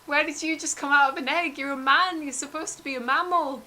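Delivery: high, loud, rushed panicked questions; a freaked-out kid blurting at the egg-man; startled Terry. Acted. Terry argues with the creature.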